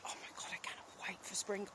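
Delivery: a fast, breathy whisper of longing; hushed and quick, can't wait for spring; eager whisper. Real, not acted. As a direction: whisper it like a secret wish for spring. Excited aside about spring.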